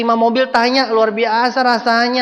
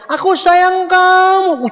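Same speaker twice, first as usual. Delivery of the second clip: higher, louder, slow yell over traffic; shouted love line; 12 semitones higher, 3 dB louder, much slower than usual. Retold or imitated. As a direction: bellow it from the back of a motorbike. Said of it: "Kencang-kencang".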